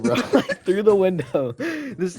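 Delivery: laughing through the words; can't hold back the giggles; real laughter while telling. Real, not acted. Laughing while retelling the stare.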